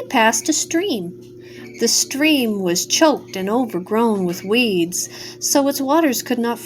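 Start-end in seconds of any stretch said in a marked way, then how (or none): none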